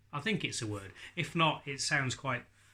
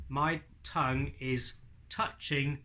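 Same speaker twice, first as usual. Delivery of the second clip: ordinary talk, nothing marked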